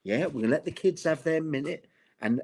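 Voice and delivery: ordinary talk, nothing marked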